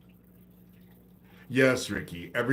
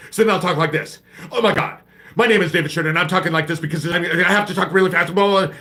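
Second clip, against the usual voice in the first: high, loud, racing parody of sped-up playback; mock sped-up voice; 10 semitones higher, 13 dB louder, much faster than usual. Mocking a video sped up to make the speaker talk fast, in a high, loud, racing mock voice. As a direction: imitate a video played too fast, pitched way up and gabbled. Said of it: "put it on one point five".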